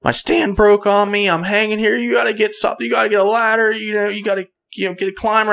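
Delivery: high-pitched, urgent pleading; retold panic. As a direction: imitate a panicked man begging for help. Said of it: "in a panic".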